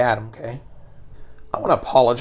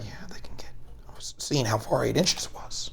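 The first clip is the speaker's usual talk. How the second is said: hushed sneaky whisper; 10 dB quieter than usual. Real, not acted. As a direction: whisper it so nobody hears. Sneaky whisper while measuring.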